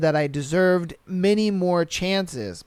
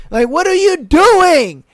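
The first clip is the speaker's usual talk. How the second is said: incredulous; 9 semitones higher, 7 dB louder, slower than usual. Real, not acted. Incredulous rhetorical question.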